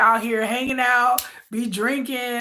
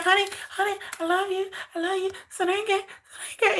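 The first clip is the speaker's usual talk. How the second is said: higher, softer coaxing; soft pleading girlfriend; 5 semitones higher, 7 dB quieter than usual. Retold or imitated. Girlfriend softly pleading with Suga for affection. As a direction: coax him sweetly.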